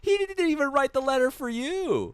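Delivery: shrieking at a clueless fan; exasperated outburst. Retold or imitated. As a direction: yell it at the screen in mock outrage. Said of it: "And I'm like".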